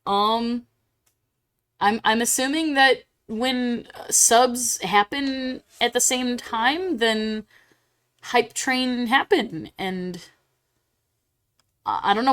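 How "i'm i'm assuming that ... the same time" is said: usual speaking voice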